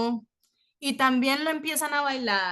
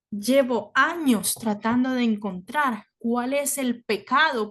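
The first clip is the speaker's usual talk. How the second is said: slow, grave realization; solemn rabbi; slower than usual. Retold or imitated. Rabbi telling the two men, slow and grave. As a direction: drag each word out in dismay.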